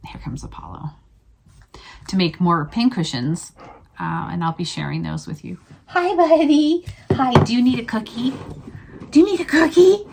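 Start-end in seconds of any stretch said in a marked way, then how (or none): 0.1-0.9 soft whispered aside; whispering. Real, not acted. Dog arrives during video. 5.9-6.8 high sweet pet voice; talking to a dog. Real, not acted. Greeting her dog Apollo. 7.1-8.3 higher pet voice asking; talking to a dog. Real, not acted. Offering dog a cookie. 9.1-10.1 talking to a dog. Real, not acted. Offering dog a cookie.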